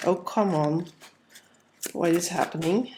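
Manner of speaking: ordinary talk, nothing marked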